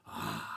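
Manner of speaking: long, very low roar; lion roar. A narrator's reading. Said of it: "And he roared again".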